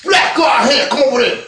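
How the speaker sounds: loud, high and heated street confrontation; acted shouting match. Acting out a hallway showdown, shouting loud and high. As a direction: yell it like you're squaring up on campus.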